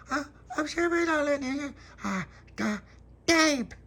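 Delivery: put-on very high, babyish mumble. Baby Lucia answering Pop, very high toddler voice. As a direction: lisp it like a tiny toddler.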